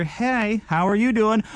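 a hint of a sweet young woman saying hi; sweet girl. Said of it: "little sweet girl over there".

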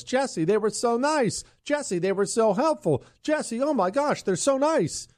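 high-pitched gushing praise; raving customer. Retold or imitated. Host quoting listeners' emails about customer service, raving in a high gush. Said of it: "it's somebody raving about".

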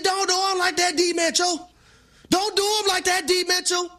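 high-pitched heckle at the TV; mock pleading. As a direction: re-enact begging the player to show mercy. Retells pleading with player on TV.

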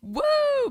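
high held woo cry; whooping cheer. Real, not acted. Cohost whooping at fifteen-dollar tickets, high cheer. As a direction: whoop like a fan in the stands.

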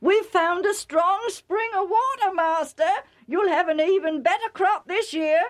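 high, eager announcement; excited news-bearer. Acted. Joktan hurrying with news to his master, excited. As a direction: burst out with good news, pitched high. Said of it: "was hurrying to give him the news".

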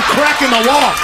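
ordinary talk

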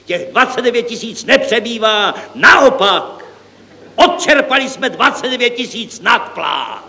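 high-pitched, triumphant announcement; superior. A narrator's reading. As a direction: declare it with lofty assurance, pitch held high. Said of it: "potvrdil s převahou hlavní účetní".

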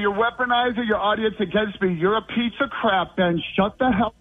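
high-pitched angry outburst; upset. Real, not acted. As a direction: lash out, cutting the host off. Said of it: "calm down. Okay, take a breath".

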